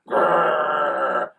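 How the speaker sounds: pitiful half-hearted growl; a slime dog's feeble growl; weak growl. Acted. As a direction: try to growl and fail. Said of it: "I'll try," replied Slime".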